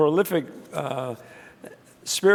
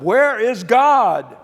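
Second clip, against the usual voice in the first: loud, high, drawn-out stern demand; 6 semitones higher, 8 dB louder, slower than usual. Retold or imitated. Minister asking the boys one more time, stern. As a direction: push it louder and higher, slow and stern. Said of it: "he looked at them very sternly".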